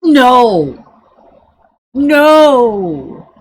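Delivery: a flat, loud refusal, twice; firm loud no. Real, not acted. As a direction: slam the door on it. Refusing the quoted phrase.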